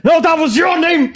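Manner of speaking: high, loud comic outrage; outraged shout. Acted. Young drow punches cheating lover, outraged shout. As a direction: yell the correction at top pitch.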